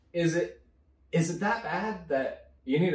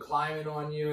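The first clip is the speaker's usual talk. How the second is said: ordinary talk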